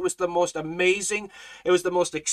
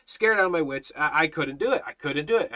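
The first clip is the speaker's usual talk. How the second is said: normal talking voice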